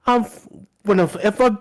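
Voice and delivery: high, put-on Scouse accent. Mimicking Paddy's hunger complaint in a high Scouse accent. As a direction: pitch it up in a Scouse voice. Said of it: "The Scouser accent".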